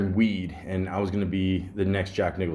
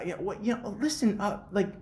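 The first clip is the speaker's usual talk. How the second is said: lightly voiced lady asking about danger; imitating the lady; 9 semitones higher than usual. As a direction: mimic her asking, worried and high. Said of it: "she's like".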